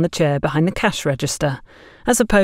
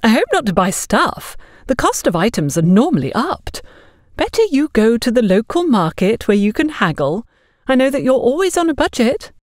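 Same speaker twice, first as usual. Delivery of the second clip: higher, patronizing neighbour voice; condescending busybody; 6 semitones higher than usual. Acted. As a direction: talk down to her sweetly. Agatha lectures Gloria condescendingly.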